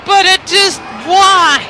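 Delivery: loud, high exasperated cry; high-pitched, incredulous outburst; exasperated bafflement. Real, not acted. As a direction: burst out in loud disbelief.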